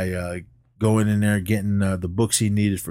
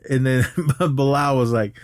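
normal talking voice

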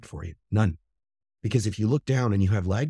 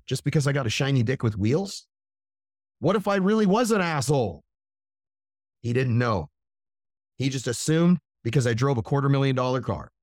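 ordinary talk

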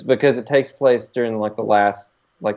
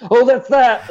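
excited; 14 semitones higher, 5 dB louder, slower than usual. Retold or imitated. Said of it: "I was like".

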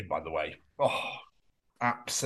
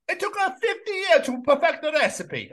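mock Italian, pitched way up; Italian accent; 10 semitones higher than usual. Defending grandma's pizza recipe, high mock Italian accent. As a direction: play it as a high, comic Italian. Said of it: "does an Italian accent".

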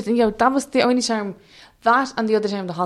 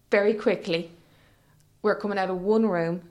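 ordinary delivery

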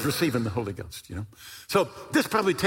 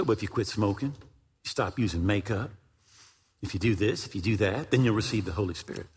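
quoted rule-giving; 6 semitones lower, 3 dB quieter than usual. Retold or imitated. Quoting people who set conditions.

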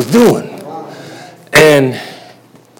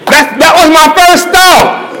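laughing; 3 dB louder, slower than usual. Real, not acted. Laughing about his reaction.

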